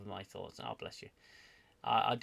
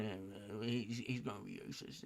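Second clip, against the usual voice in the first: normal talking voice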